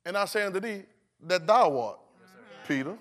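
measured, with pauses between phrases; changed tone. Shown as a demonstration. Preacher revealing Jesus's tone to congregation, deliberate pointed reading. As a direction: pause before the name and let it land. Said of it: "Then the tone, the tone is".

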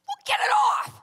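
high, loud mock shout; urgent demand. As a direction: shout the order in alarm. Friend urgently demanding, retold.

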